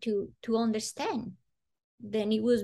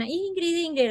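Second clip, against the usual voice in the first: high sing-song call of a name; 8 semitones higher than usual. Retold or imitated. Maid calling the child to dinner, high call.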